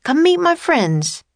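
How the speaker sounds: high-pitched, eager call; bright hail, pitched well up. A narrator's reading. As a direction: beckon a friend over from afar. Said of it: "he called".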